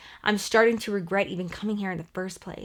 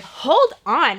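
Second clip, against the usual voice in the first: louder, higher cut-in to stop her; firm hold on; 4 semitones higher, 6 dB louder than usual. Retold or imitated. Cutting off her friend, a raised stop-right-there. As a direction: raise the voice to halt the friend mid-accusation.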